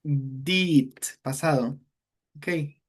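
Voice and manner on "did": usual speaking voice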